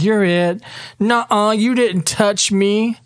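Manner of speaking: put-on high, sing-song child voices at tag; kid at play. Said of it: "you hear some kids playing games".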